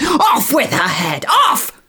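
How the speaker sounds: high, slow shrieked command; furious scream. Acted. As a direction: scream it like an enraged queen.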